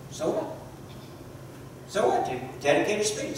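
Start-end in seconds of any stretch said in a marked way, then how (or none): 0.1-0.5 so what. Shown as a demonstration. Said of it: "should ask the question". 1.9-2.2 so what. Shown as a demonstration. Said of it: "should ask the question".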